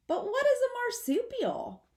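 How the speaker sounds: put-on high, fast, curious baby voice; little possum asking. Apple Blossom asking her mother in a high, quick, curious voice. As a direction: pipe up like a puzzled little one.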